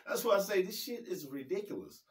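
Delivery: laughing it off. Real, not acted. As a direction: say it through a chuckle. Laughing at the story.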